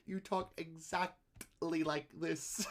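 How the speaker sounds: exaggeratedly drawn-out celebrity imitation; John Malkovich. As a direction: stretch each syllable in an odd impression. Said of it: "mention that exact bit".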